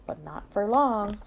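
plain reading voice

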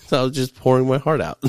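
fast laughing line; chuckling through the words. Real, not acted. Laughs while speaking.

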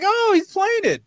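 very high, thrilled "Oh"; delighted surprise. Retold or imitated. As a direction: squeal as the crowd catches on. Crowd realizes the song.

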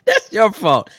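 high and loud mock scolding; playful loud accusation; loud blame. Real, not acted. Teasing scolding of co-host.

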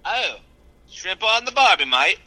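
loud, high mock-Australian; hammed-up Aussie cliché accent; fake Australian accent. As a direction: call it out in a bad Australian accent. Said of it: "your fake Australian accent".

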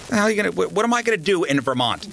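high, indignant protest; lightly voiced annoyed girlfriend; mock outrage at moving to Vermont; girlfriend's protest.

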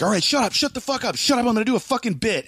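high, mock-exasperated shout without the volume; retold crowd-quieting. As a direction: impatiently order a crowd to shush, held back. Retold shushing of rowdy crowd.

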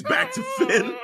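very high laughing voice; laughing mock line. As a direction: break into laughter mid-quote. Laughs imitating Finn Balor.

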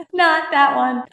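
giggling through the words; laughing denial. Real, not acted. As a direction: laugh it out high. Laughing at odd question.

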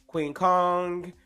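usual speaking voice

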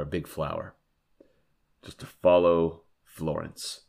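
words around a sip of coffee; while sipping a drink. Real, not acted. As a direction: talk between sips of coffee. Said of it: "Sorry if you hear me sipping my coffee".

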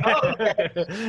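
mock "oh, okay" through a laugh; laughing reply. Imitating people who brush off paranormal work, laughing. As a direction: say it laughing, slowly. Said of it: "Then they're like".

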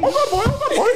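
put-on high squawky parrot with a Trinidadian accent. Telling friends how aunt's parrot spoke, squawky Trinidadian parrot voice. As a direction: mimic a parrot talking Trinidadian, way up high and loud. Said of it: "speaking like a Trinidadian".

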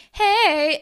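high, loud imitated hey; mock perky greeting; calling out greeting. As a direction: call out an eager hello. Character greets someone at dance.

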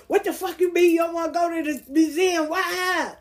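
indignant mock outburst at an ex; retold angry outburst. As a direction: re-enact being mad, pitch jumping up. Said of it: "I really got mad".

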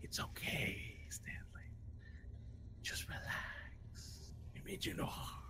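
creepy soothing whisper in the mind; a soft, slow, eerie whisper trying to calm Stanley; coaxing whisper voice. Acted. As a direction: whisper slowly to lure him in. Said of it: "this voice is going".

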